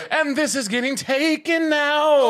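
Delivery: high, loud, drawn-out call; loud declaration. Pretend. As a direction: belt it out slowly, mock dramatic. Mock announcement, drawn out loudly.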